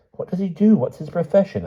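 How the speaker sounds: put-on pressing young woman. A narrator's reading. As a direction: press her for answers. Lucy voiced, pressing questions.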